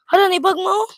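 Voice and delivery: loud startled cry; surprised. Real, not acted. Finds something in the box, loud surprised cry. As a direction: caught off guard, voice jumps high.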